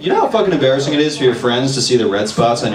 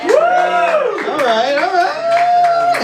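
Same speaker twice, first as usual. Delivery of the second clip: long, stretched-out all riiight, pitched up; drawn-out hype; much slower than usual. Shown as a demonstration. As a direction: stretch right out to hype the crowd. Hyping the crowd, stretched out.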